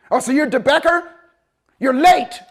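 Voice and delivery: high, loud scolding; light into the late arrival; angry drill sergeant. Retold or imitated.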